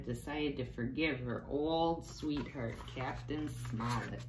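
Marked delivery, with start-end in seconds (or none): none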